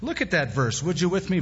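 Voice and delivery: usual speaking voice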